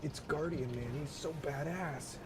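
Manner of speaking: high, slow, hushed admiration; admiring awe. Real, not acted. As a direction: gush over the cool character. Admiring the character model.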